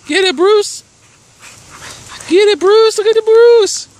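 high, loud excited cheer; root him on at full voice; cheering Bruce. Real, not acted. Owner cheering her dog Bruce on as he chases, loud high cheer.

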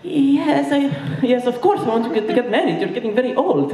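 put-on high accented mother, eager; Egyptian mom voice. Mom urging son to marry, high accented voice.

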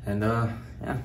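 hushed so the roommate can sleep; quiet at night. Real, not acted. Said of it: "so I don't wanna wake him up".

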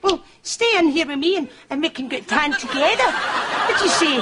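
high, coaxing come-on, laughing through it; hammed-up seduction. Shopkeeper luring a sunburnt customer to stay, mock seductive. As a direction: lay the charm on thick and silly. Shop assistant flirting with customer.

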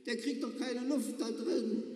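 mock the boxed heater for everyone; lightly voiced joking passenger; passenger calling out.

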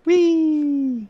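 gleeful whee. Real, not acted. Gleeful cry crossing bridge.

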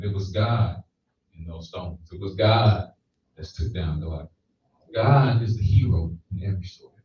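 ordinary delivery

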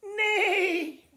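high, loud whinny; horse neigh. Acted. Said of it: "The horse said".

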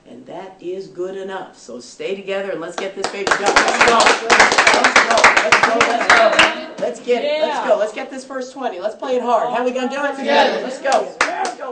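rising team-huddle shout; halftime pep speech. Real, not acted. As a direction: fire the team up, chanting. Coach firing up team before game.

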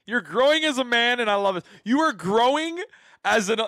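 hyped praise. Real, not acted. Praising friend's bluntness, loudly.

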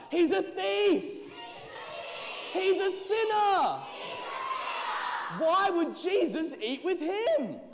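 high, very loud accusing shouts; full-force, high-pitched outcry; crowd shouting. A narrator's reading. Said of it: "Crowd began to shout".

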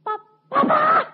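slow, high, straining outburst; stammer, then shout in astonished joy; mute wife finding voice. Retold or imitated. Istri bisu mendadak bisa bicara. (istri berteriak girang, melengking)

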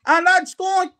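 ordinary delivery

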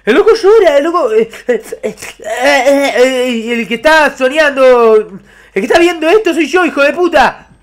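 high, loud frightened yelling at the screen; yelling in real panic at a scary madman; panicked yelling. Real, not acted. Gamer screaming in fright at the madman chasing him.

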